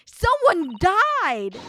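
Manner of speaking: outraged that someone died; real outrage. Real, not acted. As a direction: drag it out in disbelief. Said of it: "I, I am so angry".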